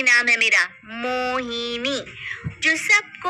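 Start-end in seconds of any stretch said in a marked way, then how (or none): 0.8-2.0 softer, very slow, seductive; soft slow name. Acted. Mohini introducing herself to her mother-in-law, soft and slow. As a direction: let the name linger softly.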